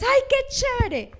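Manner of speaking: overdone mock enthusiasm; gushing delight. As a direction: ham up a thrilled listener.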